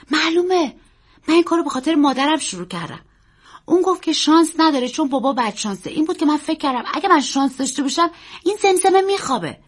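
put-on high, loud, hurried child's voice; impassioned boy. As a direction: pour out the reason like an earnest boy. Boy confides his motive.

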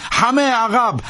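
high, commanding cry to the crowd; commanding shout. A narrator's reading. Priest ordering the crowd back, high commanding shout. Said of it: "کامرلنگو فریاد زد".

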